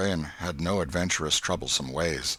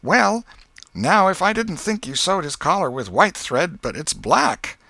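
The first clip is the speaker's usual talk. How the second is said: put-on much higher, louder, tattling boy; a smug little brother telling on Tom; Sid; 11 semitones higher, 7 dB louder than usual. A narrator's reading. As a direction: younger brother snitching to the aunt. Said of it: "But Sidney said".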